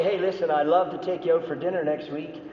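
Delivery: ordinary talk, nothing marked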